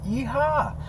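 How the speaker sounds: high joyful yeehaw; cowboy whoop. Real, not acted.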